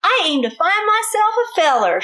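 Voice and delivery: Miss Lurleen. Acted. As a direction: sing it out like a hopeful Texas belle. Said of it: "she said".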